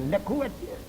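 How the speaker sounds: ordinary delivery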